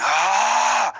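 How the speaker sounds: short high battle yell; war cry. Retold or imitated. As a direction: let out a charging war scream. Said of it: "like a war cry".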